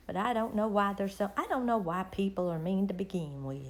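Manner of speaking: usual speaking voice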